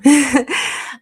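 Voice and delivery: short loud laugh; quick real laugh; burst of laughter. Real, not acted. Laughs after soldier on.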